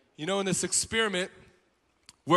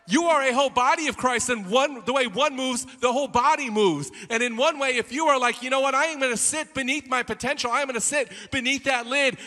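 higher-pitched, driving sermon delivery; full-force preaching; 6 semitones higher, 6 dB louder than usual. Acted. Preacher urging the congregation out of their limits, full-force preaching.

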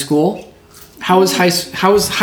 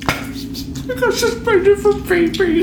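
mock teen voice; slower than usual. Mock student answering dad.

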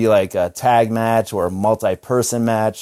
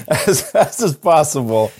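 laughing through it; 3 semitones higher than usual. Retold or imitated. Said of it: "So I'm like".

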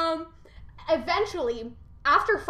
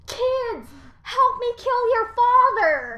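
high, loud call to arms; rally the kids against dad; rallying mother; 9 semitones higher, 7 dB louder, slower than usual. Retold or imitated. Gaia voiced in retold myth.

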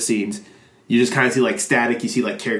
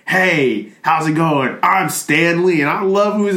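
lightly voiced Stan Lee, higher and louder; Stan Lee impression; 6 semitones higher, 4 dB louder than usual. Popping up in front of the camera, mock-hearty greeting. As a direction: pitch it up like a hammy cameo greeting. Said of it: "He's like".

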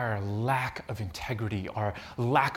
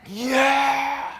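high, loud cheering yell; retelling the crowd cheering, loud; 12 semitones higher, 8 dB louder than usual. Retold or imitated. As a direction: whoop like the whole gym.

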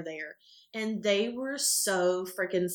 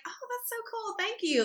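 mock sweet reply. Answering fans in line, lightly mock-sweet and high. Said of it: "I was like".

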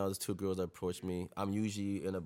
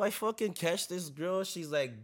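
normal talking voice

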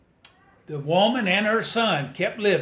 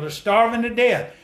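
usual speaking voice

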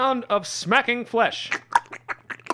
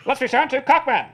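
lightly voiced vintage announcer; a hint of newsreel narration; old-timey announcer; 5 semitones higher than usual. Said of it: "like an old-timey announcer".

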